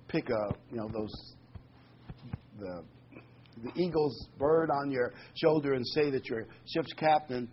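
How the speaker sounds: normal talking voice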